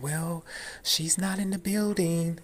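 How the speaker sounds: high, singled-out receptionist imitation; overfamiliar receptionist. Receptionist making another excuse to a caller, overfamiliar. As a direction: give the excuse in a high, coaxing voice. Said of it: "giving me that kinda tone".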